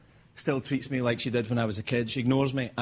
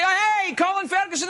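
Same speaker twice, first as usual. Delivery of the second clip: high, loud hailing shout; mock a fan calling out a name; excited recognition; 3 dB louder than usual. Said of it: "They always say".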